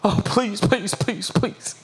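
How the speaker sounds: soft mock begging; whimpering plea. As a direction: beg meekly, in small hushed bursts. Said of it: "If you plead and grovel and cry and whimper".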